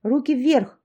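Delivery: sharp commanding shout; loud shout. Acted. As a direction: bark it like an order. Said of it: "громко крикнула".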